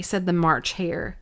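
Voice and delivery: ordinary delivery, nothing marked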